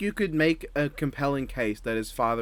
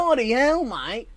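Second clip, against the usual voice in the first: hammed-up Australian accent; 10 semitones higher, slower than usual. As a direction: play a cartoonish Australian bounty hunter, pitched way up. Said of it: "Aussie outback".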